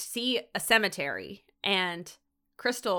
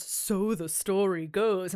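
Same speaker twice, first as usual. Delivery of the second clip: spooky storyteller; slower than usual. Retold or imitated. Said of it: "he goes".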